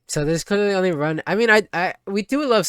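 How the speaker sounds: high, loud, eager commentary; excited rush. Real, not acted. Streamer picking a confession to the sad girl, excited. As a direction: talk yourself into the choice, thrilled.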